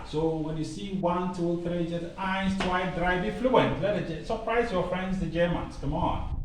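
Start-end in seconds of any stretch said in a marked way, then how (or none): none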